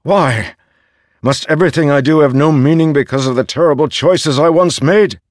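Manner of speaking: loud, high, rushed plea; begging. A narrator's reading. Dalinar begs the voice for meaning, desperate. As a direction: build to an anguished cry. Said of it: "Dalinar begged".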